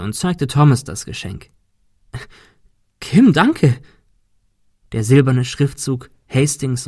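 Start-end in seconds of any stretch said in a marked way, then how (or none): 3.0-3.8 higher, louder, happily surprised; delighted thanks. A narrator's reading. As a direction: thank them with sudden delight. (freudig überrascht)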